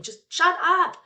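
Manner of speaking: replaying her own frazzled outburst at her kid; impatient snap. Stressed parent on her last nerve telling her child, snapping. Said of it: "like, saying".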